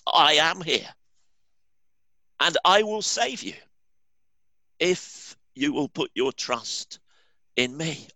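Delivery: lightly voiced heavenly voice, slowing on the promise; voice from the sky. A narrator's reading. Heavenly voice promises rescue, lightly solemn. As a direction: offer rescue, a touch solemn. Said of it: "said the voice".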